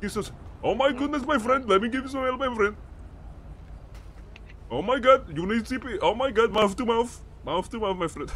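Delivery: a fake doctor fussing over a dying man; put-on foreign doctor, flustered. Fake doctor fussing over a dying patient, in a high put-on accent.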